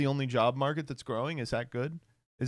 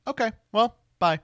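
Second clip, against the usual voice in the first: high, sheepish okay well bye; awkward goodbye; 8 semitones higher, 3 dB louder than usual. Retold or imitated. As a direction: slink off after a rejection. Skit, rejected and leaving.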